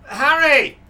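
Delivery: very loud, very high yell; top-of-the-lungs shout. Acted. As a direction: bellow the name at full force. Trying to snap Harry out.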